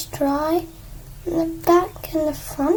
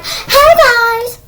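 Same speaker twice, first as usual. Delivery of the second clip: loud, high, stretched-out greeting; mock big-channel shout; hyped YouTuber; 7 semitones higher, 9 dB louder than usual. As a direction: yell it like an over-excited vlogger. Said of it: "so I don't sound like".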